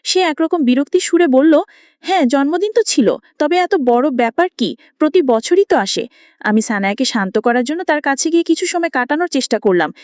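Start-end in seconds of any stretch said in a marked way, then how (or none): none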